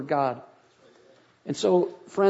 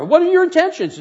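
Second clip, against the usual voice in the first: interrogating; 12 semitones higher, 5 dB louder than usual. Retold or imitated. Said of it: "I wanted to know what his intentions were".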